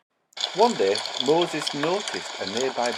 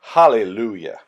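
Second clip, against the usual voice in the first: Hallelujah lower and reverent, gently falling; 5 semitones lower, 6 dB louder than usual. Acted. Storyteller as the elders bow in worship, reverent and lower.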